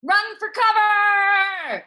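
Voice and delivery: loud panicked battle cry; shout. Acted. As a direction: yell it across the battlefield. Warrior yells in battle.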